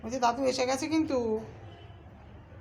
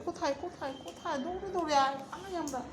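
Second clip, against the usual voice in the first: whispering while hiding; 3 semitones higher, 8 dB quieter than usual. Pretend. Urging pet to hide from grandpa.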